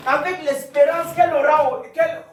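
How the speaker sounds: loud, high, shouted Bible reading; declaimed scripture. Real, not acted. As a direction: proclaim the verse at full force. Declaims scripture at full force. (lecture biblique proclamée à pleine voix)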